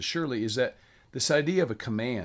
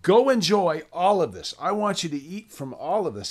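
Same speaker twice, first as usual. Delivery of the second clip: higher and louder, a joyful order to enjoy; excited command; 5 semitones higher, 5 dB louder than usual. Retold or imitated. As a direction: push it out like a generous party host. God's command, voiced excitedly.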